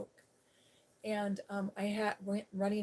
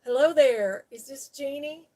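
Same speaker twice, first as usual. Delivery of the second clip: impression of a friendly officer, big booming hello; imitating sweet sheriff's officer; 5 semitones higher, 12 dB louder than usual. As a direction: imitate a sweet officer greeting someone by phone. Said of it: "he talks like this, he says".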